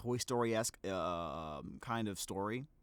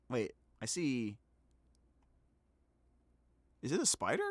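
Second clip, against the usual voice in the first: normal talking voice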